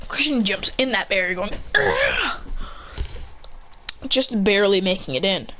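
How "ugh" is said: acted effort grunt, pitched up; straining grunt. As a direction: grunt as if diving through a gap. Straining to jump into barrier.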